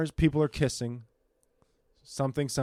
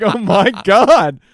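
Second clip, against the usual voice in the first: excited; 4 semitones higher, 10 dB louder, much slower than usual. Retold or imitated. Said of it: "I was like".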